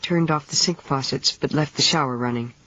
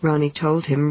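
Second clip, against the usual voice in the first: usual speaking voice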